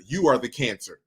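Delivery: ordinary talk, nothing marked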